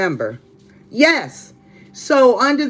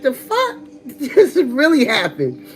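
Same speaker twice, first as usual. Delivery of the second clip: laughing disbelief; 4 semitones higher than usual. Real, not acted. Laughing at the retold shove.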